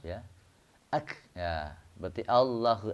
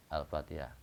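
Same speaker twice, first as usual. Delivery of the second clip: ordinary delivery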